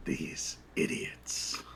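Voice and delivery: low, slow, unvoiced menacing whisper; menacing Jack. Jack looming behind the halfling, low menacing whisper. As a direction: breathe it coldly right behind him. Jack mocking the boss's words.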